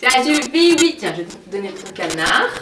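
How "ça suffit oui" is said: sharp louder telling-off; firm scolding. Real, not acted. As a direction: stop him firmly. Scolding the baby.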